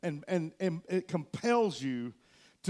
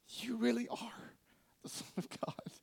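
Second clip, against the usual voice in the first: awed whisper; 5 semitones higher, 11 dB quieter than usual. Acted. Disciples fall on their faces, whispering in awe. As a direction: hush it in wonder.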